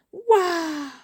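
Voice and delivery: high, drawn-out wow of wonder; amazed wow. Acted. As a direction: gasp out an awestruck, high wow. Character amazed by the dragon. (waouh émerveillé et aigu)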